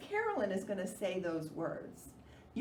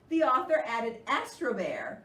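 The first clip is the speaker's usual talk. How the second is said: usual speaking voice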